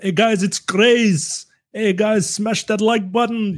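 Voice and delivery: mock foreign YouTuber. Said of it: "so that they can hear that foreign accent".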